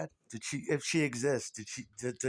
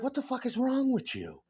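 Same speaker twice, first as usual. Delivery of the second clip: high, incredulous demand; mock outrage; 10 semitones higher than usual. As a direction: act out an appalled fan's protest. Acted outraged reaction to the outfit.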